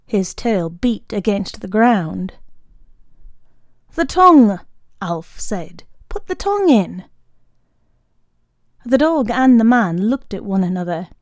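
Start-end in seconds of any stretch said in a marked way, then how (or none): 3.9-4.6 firm scolding. Acted. Alf scolds dog firmly. 6.1-7.0 insistent repeat. Acted. Alf repeats command, higher.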